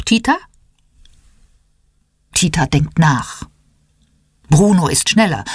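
plain reading voice

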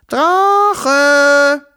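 high, loud call into the distance. Acted. Children call their dragon loudly from the garden. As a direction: shout for the missing dragon. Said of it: "riefen Wuschel und Wischel".

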